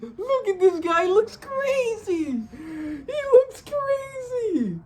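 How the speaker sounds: squealing, very high real excitement; fanboy freak-out. Real, not acted. Fan sees Rox revealed at last, squealing with excitement. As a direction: voice climbing into a stretched-out squeal.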